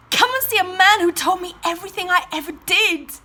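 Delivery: loud, high announcement; excited shouting. Acted. Woman tells the town about the man, shouting excitedly. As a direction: proclaim it to everyone in the street.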